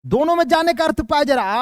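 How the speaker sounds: ordinary talk, nothing marked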